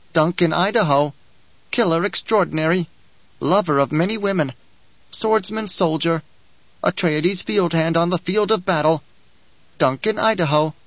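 a sly little dwarf preaching the uses of war; sly dwarf Bijaz. Acted. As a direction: lecture like a gleeful little schemer. Bijaz names Hayt's past.

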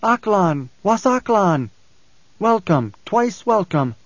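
high, ringing hail across the sand; calling out. A narrator's reading. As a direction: call out a greeting, pitch falling away. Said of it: "freed his mouth and called out".

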